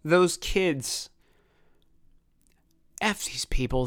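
holding back emotion, words catching in pauses; real lump in the throat, halting; choked up. Real, not acted. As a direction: struggle to get the words out. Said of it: "you can hear me get choked up".

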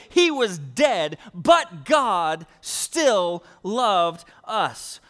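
loud, very slow emphasis; emphatic preaching. Acted. Pastor preaching to the congregation, loud and very slow. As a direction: lean on every word.

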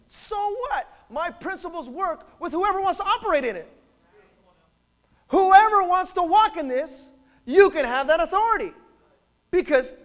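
high, loud, emphatic proclamation; full-force preaching. Real, not acted. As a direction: proclaim it to the whole congregation at full voice.

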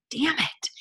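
high, whiny damn it; mock frustration. Told to cook at home, a high whiny mock groan. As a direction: pout it like a spoiled kid.

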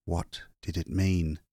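usual speaking voice

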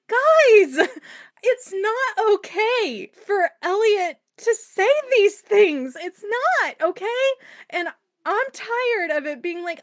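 high, choked-up rant, near tears; tearful venting. Real, not acted. Book reviewer venting to her listeners, tearful and upset. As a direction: let it crack with real upset, then push on. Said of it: "that's my vent fest".

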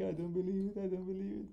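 high, mock tearful disbelief; imitating a teammate's high, choked-up disbelief; crying teammate. Teammate imitated after reaching the finals, high and tearful. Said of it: "He's like".

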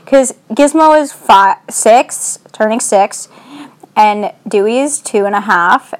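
ordinary talk, nothing marked

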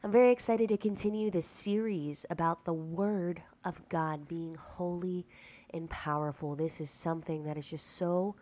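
ordinary talk, nothing marked

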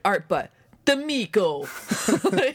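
put-on "Miko" in a playful New York mobster accent. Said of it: "like, a New York accent".

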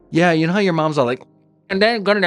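high, put-on Roseanne-style voice from fans; people's Roseanne voice. Fans greeting the son in his mom's voice, high mock Roseanne. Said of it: "they all do that voice".

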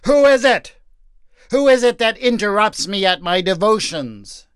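loud, high, annoyed shout; husband yelling at an interruption, frustrated; frustrated outcry. Acted. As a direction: bark out at the disturbance. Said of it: "he cried out in frustration".

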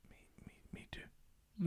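slow, breathless whispered joke. Real, not acted. Co-host whispering a joking confession to his partner. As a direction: whisper it like a guilty secret.

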